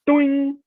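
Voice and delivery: ringing high "doing"; high springy boing. Retold or imitated. Angler showing the line jump again, high twangy boing. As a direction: voice the line's twang as a high sound effect. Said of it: "the word doing, you know, 'cause it was like that".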